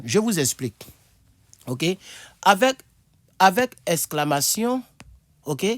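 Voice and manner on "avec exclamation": ordinary talk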